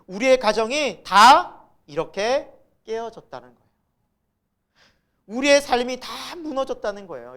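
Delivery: loud, high preaching voice; impassioned preaching. Real, not acted. Telling the congregation all our lives are broken, impassioned preaching. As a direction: drive it home to the congregation. Preaches the point at full force.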